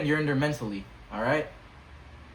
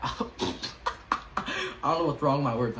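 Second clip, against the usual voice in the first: laughing; much slower than usual. Real, not acted. Laughing at own slip.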